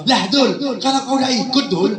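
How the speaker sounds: mock accusing bully; taunting scolding. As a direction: needle a friend about his duty. Friend teasingly scolds Abdul, acted.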